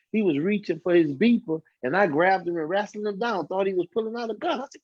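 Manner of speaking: ordinary talk, nothing marked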